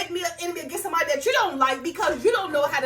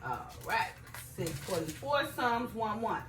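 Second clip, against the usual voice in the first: soft, low murmur while finding the page; talking to self; 6 semitones lower, 11 dB quieter than usual. Real, not acted. Preacher locating Psalms one one, murmuring to herself. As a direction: read the reference quietly to yourself.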